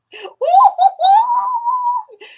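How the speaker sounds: high loud joyful shriek of laughter; real delighted shriek. Real, not acted. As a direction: burst into a squealing laugh. Huge laugh after gift.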